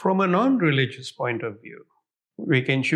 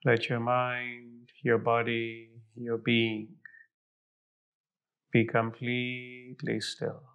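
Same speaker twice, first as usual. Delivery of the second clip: guided meditation; 3 semitones lower, 3 dB quieter than usual. Acted. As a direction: fade softer toward silence. Guided visualization meditation continues.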